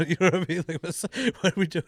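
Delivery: laughing high and loud in disbelief; incredulous laughing rant. Real, not acted. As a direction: crack up while asking. Laughing disbelief at TSA rule.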